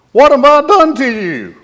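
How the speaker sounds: high, loud talking-donkey voice; hurt donkey crying out to its master; pleading donkey. Acted. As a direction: squeak it out as the wronged donkey.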